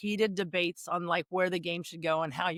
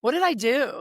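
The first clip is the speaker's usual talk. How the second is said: surprised; 5 semitones higher, 6 dB louder than usual. Retold or imitated. Old rival suddenly hugs her.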